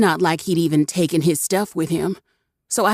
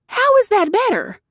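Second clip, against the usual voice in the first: sharp, much higher snap; snapping; 3 dB louder than usual. A narrator's reading. Usha snaps at Warwick, sharp and high. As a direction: bite back at him. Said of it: "Usha snapped".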